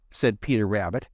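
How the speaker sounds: ordinary delivery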